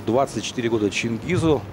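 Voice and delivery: normal talking voice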